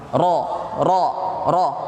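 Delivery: "Ro" said thick and full from the back of the mouth. Shown as a demonstration. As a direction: make "ro" heavy and rounded. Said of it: "Huruf roh ini kan tebal". ("ro" dibaca tebal)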